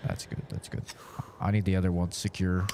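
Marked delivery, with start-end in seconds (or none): 0.1-0.8 soft hushed whisper. Real, not acted. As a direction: breathe it so you don't slip. Careful delicate hold.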